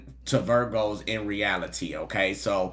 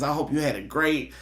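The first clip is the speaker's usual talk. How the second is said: normal talking voice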